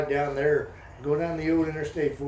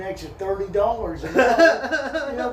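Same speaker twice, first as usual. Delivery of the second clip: laughing; 6 semitones higher, 4 dB louder than usual. Real, not acted. Joking about SF parking fees.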